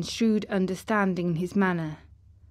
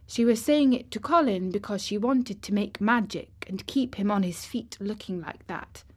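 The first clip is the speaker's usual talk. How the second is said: ordinary delivery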